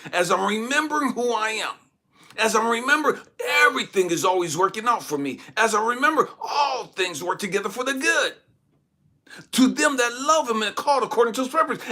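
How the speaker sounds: high, loud, driving declaration; full-force preaching. Real, not acted. Preacher remembering scripture while pinned down, triumphant and forceful. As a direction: build phrase on phrase at full voice.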